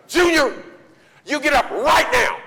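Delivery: furious high shout; mom yelling. Acted. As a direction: scream it at the boy, all out. Mom finally yelling at son.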